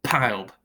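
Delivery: piled said as one quick falling beat, pyld; piled in one unbroken beat; piled in one syllable. Shown as a demonstration. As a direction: say piled as a single syllable rhyming with mild. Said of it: "we could just say piled".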